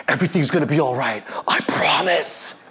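whispered, quick reassurance, slowing on the promise; hushed promise. Retold or imitated. Action hero whispers to his scared daughter, hushed promise. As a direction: breathe it like a movie hero calming someone.

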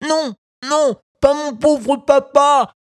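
very high, loud despairing wail; desperate scream. Acted. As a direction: cry out in desperate terror. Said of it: "hurla Pinocchio désespéré".

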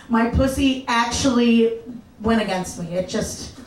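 ordinary talk

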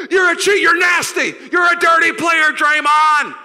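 high, loud heckling shout; hammed-up outraged crowd yelling; hostile fans going at him. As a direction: berate the player from the stands. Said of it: "they just go at him".

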